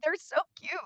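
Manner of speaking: gushing. Real, not acted. Doormats with wiener dogs.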